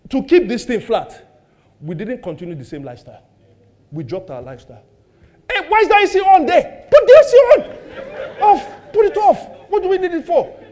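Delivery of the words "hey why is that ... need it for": a preacher acting out shouting at home; mock yelling. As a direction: bark at the household to switch the AC off. Acting out scolding about the AC.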